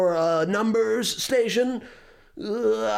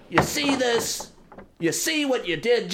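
normal talking voice

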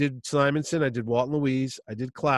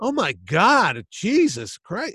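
exasperated groan; 6 semitones higher, 5 dB louder, much slower than usual. Retold or imitated. Chaykin exasperated.

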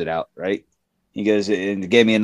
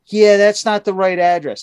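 higher, offhand dispatcher admission; mimicking dispatcher; 7 semitones higher than usual. The dispatcher admitting the address was wrong, higher and offhand. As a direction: say it higher and casually, mocking. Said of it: "I'm like".

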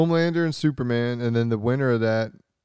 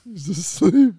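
higher mimicking superhero parody voice; playful put-on vigilante voice; Batman; 7 semitones higher, 3 dB louder than usual. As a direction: echo the comic hero line. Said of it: "I like the Bat Metal voice".

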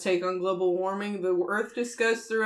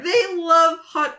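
slow, higher, half-sobbing; mock crying; 5 semitones higher, 6 dB louder, much slower than usual. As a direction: fake whimper it out. Mock crying over hot cocoa.

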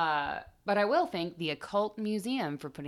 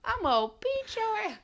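mock angry mom. Acted mother threat.

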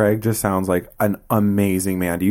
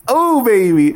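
high, loud, drawn-out whoop; playful hype; 14 semitones higher, 6 dB louder, slower than usual. Pretend. Host introducing favourite subject to guest, playful hype. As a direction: belt it high with mock relish.

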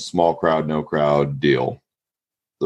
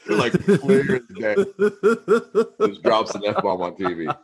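laughing; 14 semitones higher than usual. Real, not acted. Laughing while retelling.

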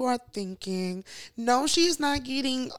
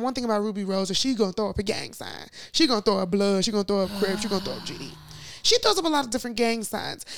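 ordinary talk, nothing marked

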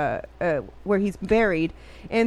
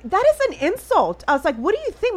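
high-pitched indignant protest; retold going off; 8 semitones higher than usual. Retelling telling officer it insulted mother, indignant. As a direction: go off on them in a raised pitch. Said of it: "I'm, like, going off on it".

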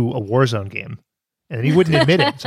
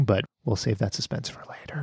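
whisper; 2 semitones lower, 9 dB quieter than usual. Acted. Teasing a secret.

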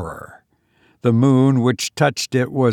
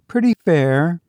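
put-on shivering young woman; 6 semitones higher than usual. A narrator's reading. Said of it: "She shivered".

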